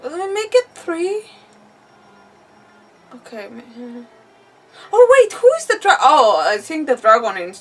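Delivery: ordinary talk, nothing marked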